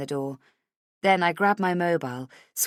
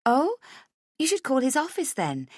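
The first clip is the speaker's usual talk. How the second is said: higher, bright and friendly; bright friendly hello; 9 semitones higher than usual. A narrator's reading. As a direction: answer the phone sunnily. Heather surprised, helpful.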